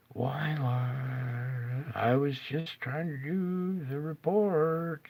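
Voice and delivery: soft, slow, plaintive whine to the Lord; a quiet, slightly hammed-up complaint; mock lament. Real, not acted. Weatherman mildly laments to the Lord that his report failed.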